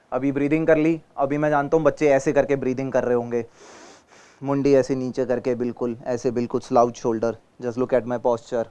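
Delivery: usual speaking voice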